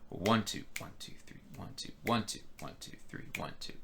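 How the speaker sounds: each ONE voiced, the rest of the count whispered; counting with accents. Shown as a demonstration. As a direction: lean on every ONE, breathe the other numbers. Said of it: "emphasizing the right notes".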